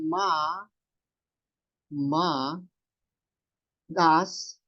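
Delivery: slow "ma" with a long a and no r; dropped R. Shown as a demonstration. Teacher converts the name to Japanese, "ma" stretched without r. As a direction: spell out "ma, das" in separate drawn-out pieces. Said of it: "Yung R dyan ay mawawala na".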